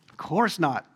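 ordinary talk, nothing marked

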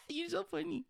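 laughing through the words. Real, not acted. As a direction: laugh it out, real amusement. Laughing at co-host's reaction.

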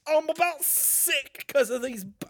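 put-on British accent, movie parody. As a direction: protest in a comic British voice. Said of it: "Can we do my British voice again?".

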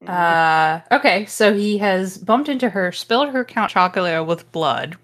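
normal talking voice

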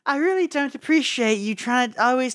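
high, badly faked British accent; hammed-up failed British accent. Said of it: "isn't even speaking in a British accent".

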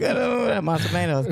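high-pitched mock Spanish jabber; imitated Spanish chatter. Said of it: "she's speaking Spanish".

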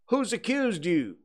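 high-pitched call from a crowd; shouted challenge. A narrator's reading. Voice in the crowd to Potter, shouted challenge. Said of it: "shouted a voice".

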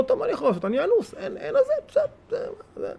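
hint of a resigned sigh; mock resignation. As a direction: imitate someone shrugging it off. Imitating resigned person.

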